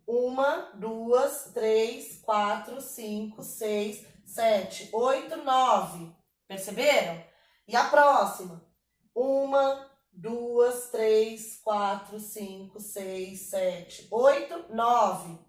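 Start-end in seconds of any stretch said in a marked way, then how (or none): 0.1-6.1 slow, evenly spaced count. Shown as a demonstration. Teacher counting the verse's syllables for students, measured counting. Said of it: "vamos contar aqui". 9.1-15.4 slow, evenly spaced count. Shown as a demonstration. Teacher counting the verse's syllables for students, measured counting. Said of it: "vamos contar aqui".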